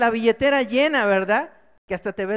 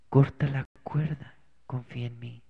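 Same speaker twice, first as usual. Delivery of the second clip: calm divine voice; 9 semitones lower, 6 dB quieter than usual. Acted. God calmly repeats the order.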